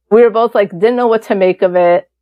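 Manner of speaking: ordinary talk, nothing marked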